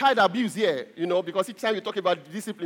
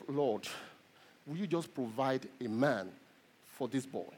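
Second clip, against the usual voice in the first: a low, much softer murmured prayer; hushed prayer; 7 semitones lower, 11 dB quieter than usual. Retold or imitated. Pastor praying for the boy in his office, hushed and low. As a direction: pray it under your breath.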